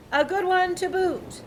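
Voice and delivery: high chorus of pirates; pirate crew agreeing. A narrator's reading. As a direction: echo it like a rowdy crew. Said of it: "the others agreed".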